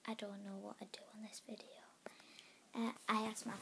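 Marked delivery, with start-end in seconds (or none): 0.1-1.7 whispering. Real, not acted. Whispering to herself, unvoiced.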